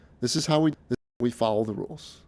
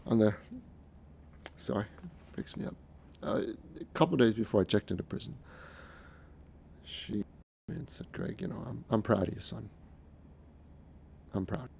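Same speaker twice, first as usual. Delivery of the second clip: softly, fighting back emotion, with halting pauses; real emotion catching the voice, words coming out small; choked up; 7 dB quieter than usual. Real, not acted. Son recalling his mother's words before prison, choked up. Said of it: "getting a little emotional here".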